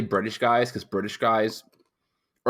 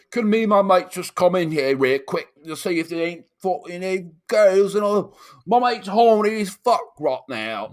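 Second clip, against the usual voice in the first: high, loud, sloppy British lad; drunk British kid; 10 semitones higher, 7 dB louder than usual. Retold or imitated. Imitating a cross-eyed kid pleading with a bouncer, drunk British. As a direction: plead in a thick, boozy British accent. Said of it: "he sounded like he was".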